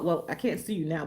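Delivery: ordinary talk, nothing marked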